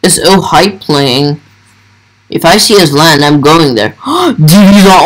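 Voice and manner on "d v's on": gasp, then blurt it out excitedly; sudden thrilled surprise. Real, not acted. Gamer spotting favourite YouTuber online, excited gasp.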